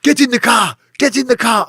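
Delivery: high urgent mock command; retold gunman shouting get in the car; gunman's order. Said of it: "puts a gun to my head and goes".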